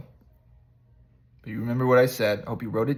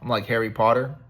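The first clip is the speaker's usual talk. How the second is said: ordinary talk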